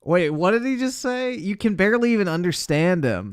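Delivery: high, disbelieving outburst; baffled. Real, not acted. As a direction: react like you can't believe it. Baffled by Biden's words.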